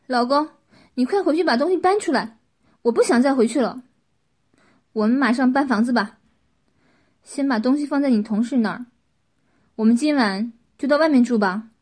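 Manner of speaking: loud, urgent appeal to a spouse; agitated urging. A narrator's reading. Shaken Qin to partner outside, urgently loud about moving out.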